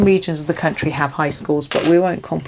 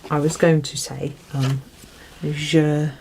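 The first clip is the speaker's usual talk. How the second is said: normal talking voice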